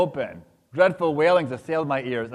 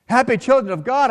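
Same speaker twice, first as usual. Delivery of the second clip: much higher and louder exclamation; an ecstatic cry upward; crying out in awe; 10 semitones higher, 6 dB louder than usual. A narrator's reading. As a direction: cry out to the blessed in wonder. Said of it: "I cried".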